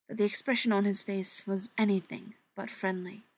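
ordinary delivery

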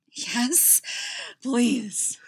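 high, loud breathy plea; breathless eagerness. A narrator's reading. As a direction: plead eagerly on short breaths. Breathless eager answer to lover.

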